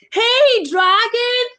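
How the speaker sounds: very high, loud holler; full shout. Acted. Princess waking the sleeping dragon, shouting full out. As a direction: yell at the top of the voice. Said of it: "she shouted as loud as she could".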